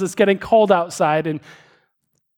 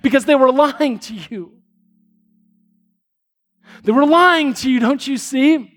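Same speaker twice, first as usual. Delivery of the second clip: impassioned; 6 semitones higher than usual. Acted. Preacher presses the point.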